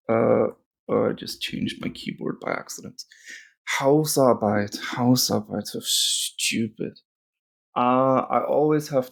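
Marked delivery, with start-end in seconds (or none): 1.1-3.0 whispered, much softer; unvoiced aside to self. Real, not acted. Muttering to herself at keyboard. 5.7-7.0 slow whispered, softer; unvoiced mutter. Real, not acted. Muttering at herself.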